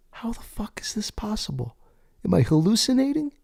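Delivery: high stunned whisper; whispered disbelief. Retold or imitated. Thinking to himself in a high stunned whisper. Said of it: "I'm thinking to myself".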